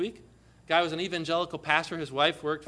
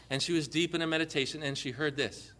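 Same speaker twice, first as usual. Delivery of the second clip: ordinary talk, nothing marked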